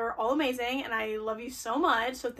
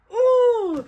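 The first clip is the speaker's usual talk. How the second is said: much higher, louder squealed ooh; thrilled ooh; 11 semitones higher, 11 dB louder than usual. Real, not acted. Vlogger finds signed book, high loud squealed ooh. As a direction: squeal with delight.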